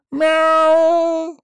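loud, very high outraged cat cry; cat yowling in protest; indignant meow. A narrator's reading. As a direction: yowl like an offended cat. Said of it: "возмущённо выкрикнул котяра".

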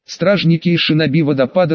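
usual speaking voice

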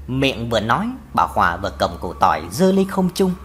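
usual speaking voice

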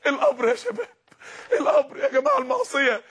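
high, crying plea about the grave; tearful cry. Real, not acted. Preacher to youth, tearful high crying plea. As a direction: break into tears as you warn them.

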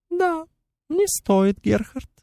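high, thin, chirring insect voice; thin chittering voice. A narrator's reading. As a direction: chitter it like a tiny fairy. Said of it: "тонким, чуть стрекочущим голосом".

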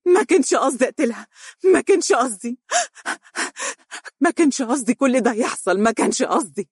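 high, fast, crying out in breakdown; a broken man shrieking his denial; sobbing scream. Acted. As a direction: wail the confession through tears. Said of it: "وظل يهتف صارخا".

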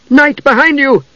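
high urgent warning shout; scream. A narrator's reading. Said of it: "The woman screamed".

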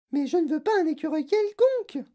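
put-on very high child shriek; Veruca's yell. A narrator's reading. Spoilt girl rejecting mother's promise, yelling. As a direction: scream in protest. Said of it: "hurla Veruca".

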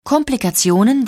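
usual speaking voice

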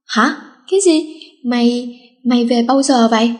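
usual speaking voice

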